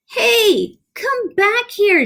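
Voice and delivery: high squeaky mouse yell; officer shouting after his runaway troops. A narrator's reading. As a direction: call the fleeing troops back. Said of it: "shouted the Big Cheese".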